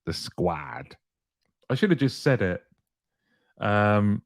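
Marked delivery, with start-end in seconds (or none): 0.1-0.9 lower, softer mock street voice; lightly put-on streetwise drawl; street slang voice. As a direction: drop into a hammed-up street tone. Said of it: "For the peeps that aren't down with the street".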